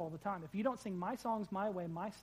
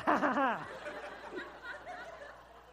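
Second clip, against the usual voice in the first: laughing; 7 semitones higher than usual. Real, not acted. After the hand joke.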